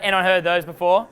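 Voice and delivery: normal talking voice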